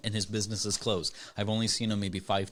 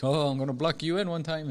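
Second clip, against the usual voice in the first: higher, lightly put-on African accent; imitated African accent; 6 semitones higher than usual. Owner warning the mail carrier, imitated African accent. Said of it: "African accent".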